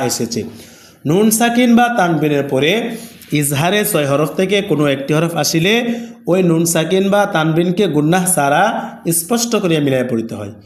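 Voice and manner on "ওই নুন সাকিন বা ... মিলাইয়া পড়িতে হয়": ordinary delivery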